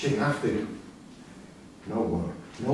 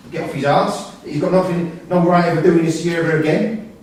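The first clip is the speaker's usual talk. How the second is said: loud, building rant; rising furious rant; angry rant; 3 semitones higher, 7 dB louder than usual. Acted. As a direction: lay into him, getting louder. Father vowing to confront son.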